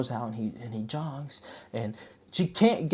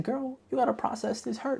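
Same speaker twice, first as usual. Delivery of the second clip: put-on much higher, sing-song girlfriend advice; high sassy advice; 12 semitones higher, 4 dB quieter than usual. Viewer advising the heroine, in a high sassy voice. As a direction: coo it like a sassy bestie. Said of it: "I said".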